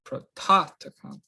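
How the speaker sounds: "prototokon" as pro-TOT-o-kon; accent on middle omicron. Shown as a demonstration. Teacher repeats for students, "prototokon" stressed on the second syllable. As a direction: lean on TOT in "prototokon", then fall. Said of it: "middle Omicron is the one that has the accent".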